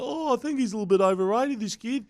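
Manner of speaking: high, smug commentator voice; snooty commentator impression; mocking Blocker Roach. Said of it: "He's like".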